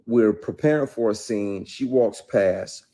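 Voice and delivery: normal talking voice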